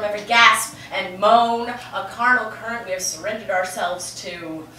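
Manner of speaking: a lightly dramatic poetry reading; poem recital. Acted. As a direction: recite it with mild grandeur.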